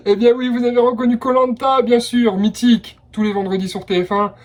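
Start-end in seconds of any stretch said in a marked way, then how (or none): none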